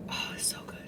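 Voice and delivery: a whispered, savoring aside; hushed delight. Real, not acted. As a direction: breathe it out like a secret pleasure. Savoring the latte alone.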